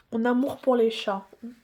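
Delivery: ordinary talk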